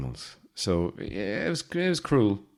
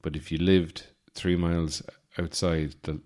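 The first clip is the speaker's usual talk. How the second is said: usual speaking voice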